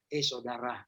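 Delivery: hushed, careful mock-gentle rebuke; gentle reproach. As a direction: correct someone without hurting them. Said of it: "gitu ngomongnya".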